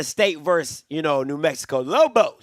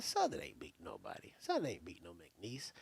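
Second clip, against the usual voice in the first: dismissive; 12 semitones lower, 18 dB quieter than usual. Real, not acted. Low dismissive mutter.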